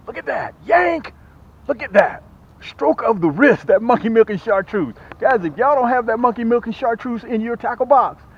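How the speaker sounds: loud, high, thrilled hype about the catch; excited yelling. Real, not acted. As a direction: sell the bait at full excitement. Excited after hooking a fish.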